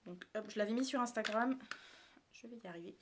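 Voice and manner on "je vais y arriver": quiet self-encouragement. Real, not acted. Vlogger coaxing herself through a one-handed task, quietly. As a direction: say it quietly to yourself.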